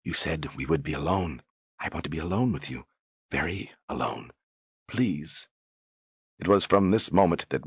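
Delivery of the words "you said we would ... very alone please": hushed, intimate pleading; soft pleading. Acted. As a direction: murmur it close to his ear. Whispering close to his ear.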